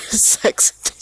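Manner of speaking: words breaking up into laughter; incredulous laughing. Real, not acted. Child scoffing at being accused at eleven, real laughing. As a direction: laugh through the absurd accusation.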